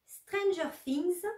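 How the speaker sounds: Stranger Things said with a slow, careful English accent; English title said in English. Real, not acted. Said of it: "Désolée pour mon accent". (prononcé avec l'accent anglais, lentement)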